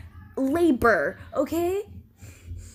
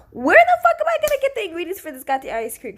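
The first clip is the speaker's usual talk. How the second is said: loud, fast, high-pitched indignation; outraged retort; 5 semitones higher, 6 dB louder, faster than usual. Retold or imitated. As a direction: act out her exasperation, rushing loudly. Voicing the woman's outraged retort.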